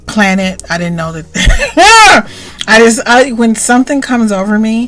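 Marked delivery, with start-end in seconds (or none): 1.3-2.6 high burst of real laughter; can't hold back the laugh; loud laugh. Real, not acted. Laughs mid story.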